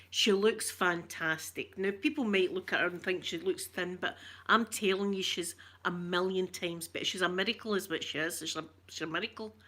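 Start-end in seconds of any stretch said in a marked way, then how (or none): none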